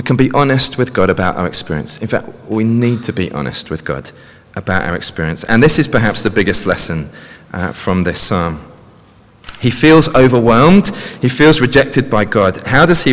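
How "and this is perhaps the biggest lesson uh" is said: ordinary delivery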